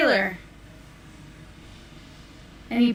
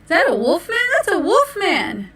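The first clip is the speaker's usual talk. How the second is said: surprised; 7 semitones higher, 4 dB louder than usual. Acted. Narrator startled by wolf.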